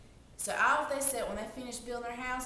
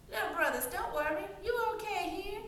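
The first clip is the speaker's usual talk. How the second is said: higher, soothing piggy voice; little pig brother; 10 semitones higher, faster than usual. Acted. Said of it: "and he said".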